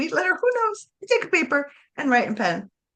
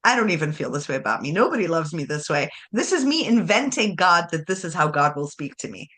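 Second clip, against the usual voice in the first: mock skeptical scoff; 8 semitones lower than usual. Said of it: "you're gonna be like".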